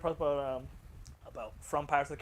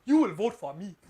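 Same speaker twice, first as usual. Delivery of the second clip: louder, higher movie-pirate demand; African pirate; 4 semitones higher, 6 dB louder, slower than usual. Retold or imitated. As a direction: imitate a commanding African pirate. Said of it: "then the African pirate".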